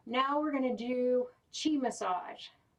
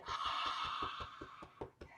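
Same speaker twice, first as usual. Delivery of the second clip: soft airy ha release; dramatic sigh; 10 dB quieter than usual. Shown as a demonstration. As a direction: breathe out a long soft sigh. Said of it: "the heart sound".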